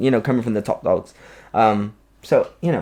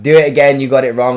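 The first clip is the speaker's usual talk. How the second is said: louder, higher mock telling-off; mock bossy order; 4 semitones higher, 11 dB louder than usual. Dancers mock-scolding the choreographer, loud and playful. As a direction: bark it like a pretend strict boss. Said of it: "we would be like".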